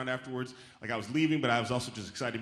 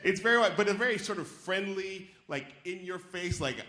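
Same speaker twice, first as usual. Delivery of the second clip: ordinary delivery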